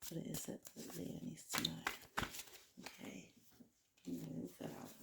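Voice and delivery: very soft muttering to oneself; barely audible murmur. Real, not acted. Reader privately asking what Leo needs to know, murmured. Murmuring to self while drawing cards.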